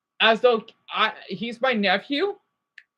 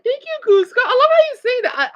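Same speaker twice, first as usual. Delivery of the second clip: high-pitched, gushing thanks; excited, much higher voice; delighted thanks; 12 semitones higher, 5 dB louder than usual. Real, not acted. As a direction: jump up high to thank a viewer. Thanking a viewer mid-rant.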